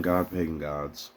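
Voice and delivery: ordinary talk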